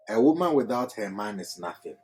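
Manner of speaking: "A woman without her man is nothing" run together quickly in one breath; one smooth flow. Shown as a demonstration. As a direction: read it through without a break. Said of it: "I read it in a certain flow".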